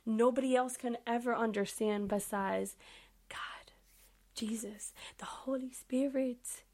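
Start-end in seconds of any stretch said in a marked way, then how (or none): 3.3-6.6 hushed, reverent whisper; whispering the holy names softly. Real, not acted. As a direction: drop to a breathy whisper.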